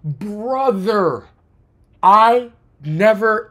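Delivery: usual speaking voice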